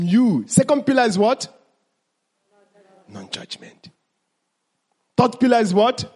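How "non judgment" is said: breathy whisper, "Non-judgment" voiceless and hushed; soft whispered answer. Shown as a demonstration. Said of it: "teacher repeats pillar as whisper".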